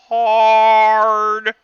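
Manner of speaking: one long, loud, high held word; big ringing emphasis; drawn-out emphatic shout. Real, not acted. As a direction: bellow it and hold the vowel. Game master stresses a heavy hit.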